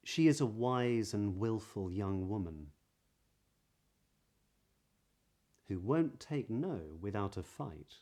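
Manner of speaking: measured reading with rounded W in "wise and willful"; practice sentence. Shown as a demonstration. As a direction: round each W of "young woman", pause between phrases. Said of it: "here are some sentences".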